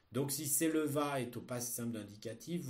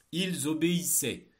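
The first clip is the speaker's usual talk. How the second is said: dictating; 5 semitones higher, 8 dB louder than usual. Shown as a demonstration. Teacher dictating a text.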